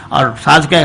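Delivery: ordinary delivery, nothing marked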